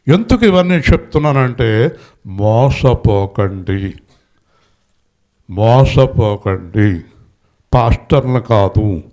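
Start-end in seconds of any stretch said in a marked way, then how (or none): 2.3-7.0 slow warning. Real, not acted. Preacher warning the congregation.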